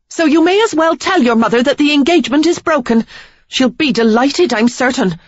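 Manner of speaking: high, loud, furious; storming off. A narrator's reading. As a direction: hurl the words over your shoulder. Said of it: "storm from the stable, throwing words".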